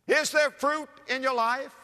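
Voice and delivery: high-pitched preacher's challenge, rising at the end; urgent challenge. Real, not acted. Preacher challenging church members about their lives, urgent. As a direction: press the question hard, voice lifted. Same question repeated as challenge.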